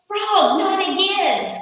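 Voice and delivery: high, loud mousy squeal; loud squealed protest. A narrator's reading. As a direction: squeal in exasperation at Frog. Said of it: "squealed Mouse".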